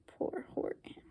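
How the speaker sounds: very soft breathy whisper of pity. A narrator's reading. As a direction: whisper it sadly. Narrator pities Horton.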